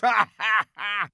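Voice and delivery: high, loud jeering laugh; mean laughter. A narrator's reading. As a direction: laugh meanly at him. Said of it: "roar of mean laughter".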